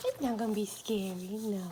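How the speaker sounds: ordinary talk, nothing marked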